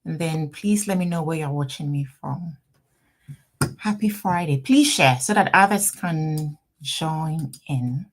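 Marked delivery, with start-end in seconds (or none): none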